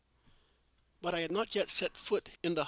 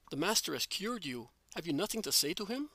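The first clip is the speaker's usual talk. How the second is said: usual speaking voice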